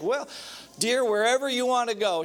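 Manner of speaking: a husband sweet-talking his wife out of choosing, high and placating; nervous husband dodging. Retold or imitated. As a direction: dodge the question like a cornered husband. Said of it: "And he's like".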